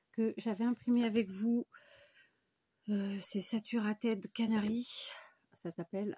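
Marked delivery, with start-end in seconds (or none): 2.9-4.9 English title read slowly in a French accent; slow English name with a French accent. Real, not acted. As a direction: say Saturated Canary the French way, slowly. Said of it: "avec mon accent français".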